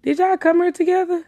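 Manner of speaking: high-pitched, careful casual question; trying to be cool. Retold or imitated. Woman asking a big man about her friend, trying to be cool. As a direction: ask lightly, pitched well up. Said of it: "trying to be cool".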